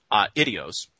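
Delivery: "uh, idios" as "ah" plus "ID-ee-os"; two separate syllables. Shown as a demonstration. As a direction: keep the first two vowels of "uh, idios" apart, ah-ee. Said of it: "The other option".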